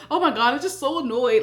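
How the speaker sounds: quick, put-on voice of an annoyed fiance; imitating annoyed fiance. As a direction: mimic him griping fast.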